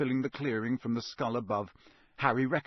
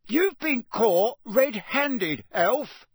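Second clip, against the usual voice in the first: raised, louder, very slow roar; roared accusation; 10 semitones higher, 6 dB louder, much slower than usual. A narrator's reading. Official accusing the elf, roaring. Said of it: "Mister Diggory roared".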